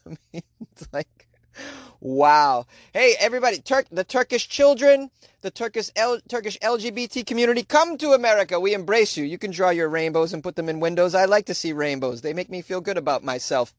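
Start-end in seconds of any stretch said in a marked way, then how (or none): 2.9-10.3 high, raised-voice invitation; big mock public appeal; calling out. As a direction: call out to a whole crowd. Calls out to Turkish children.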